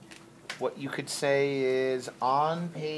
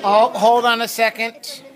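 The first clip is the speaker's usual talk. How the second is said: loud; 8 semitones higher, 15 dB louder than usual. Real, not acted. Teacher stops the class discussion.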